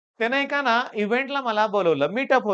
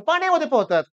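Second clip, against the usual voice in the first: पाण्यामध्ये पोहतात yelled out louder and higher; shouted; 8 semitones higher, 5 dB louder, faster than usual. Shown as a demonstration. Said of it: "असं ओरडून नाही बोललो". (ओरडून मोठ्याने)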